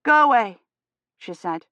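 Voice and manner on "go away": frightened order. A narrator's reading. Warding off the cutthroat.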